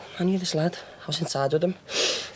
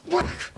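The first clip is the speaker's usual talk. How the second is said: mock whack; 14 semitones higher than usual. Retelling a beating.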